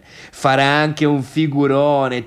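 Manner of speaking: normal talking voice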